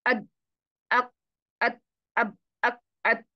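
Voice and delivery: अद, अक, अत said with the sound cut dead; unbounced stopped letters. Shown as a demonstration. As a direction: close each letter with no release. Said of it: "मैंने इन सबको साकिन किया पर मुझे तो आवाज सुनाई नहीं दे रही ना". (अद अक अत बिना उछाल के, आवाज बंद)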